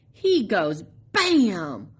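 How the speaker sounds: plain reading voice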